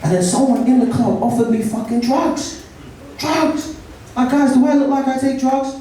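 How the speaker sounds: high, incredulous outrage; indignant. Real, not acted. Comic protesting to the crowd about being offered drugs. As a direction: protest in mock offence.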